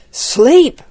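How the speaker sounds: high, loud startled exclamation; incredulous cry. A narrator's reading. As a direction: cry it out in disbelief. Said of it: "cried Jack".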